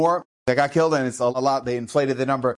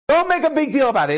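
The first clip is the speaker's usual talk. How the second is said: higher, pressing plea; urgent plea; 10 semitones higher, 3 dB louder, faster than usual. Retold or imitated. Grandfather urging the rabbi to drop it, higher and pressing. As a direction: press him hard not to fuss.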